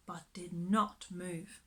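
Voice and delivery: ordinary delivery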